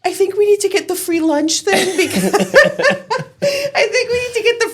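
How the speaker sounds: very high, loud, through laughter; laughing child quote. Retold or imitated. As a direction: voice her daughter while cracking up. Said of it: "my daughter's telling the teacher".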